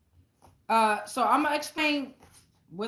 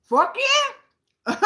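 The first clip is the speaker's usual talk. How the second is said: high, loud whoop breaking into a laugh; laughing shout; 12 semitones higher, 9 dB louder than usual. Retold or imitated. Tarot reader recalling answering the spirits, laughing high shout. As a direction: yell it and crack up laughing. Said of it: "And I was like".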